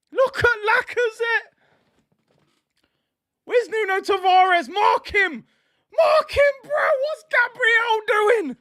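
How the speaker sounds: very high, wailing disbelief; exasperated outcry. Real, not acted. Fan blaming defenders for the second goal, high exasperated cries. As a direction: cry out at each defender's blunder, pitch way up.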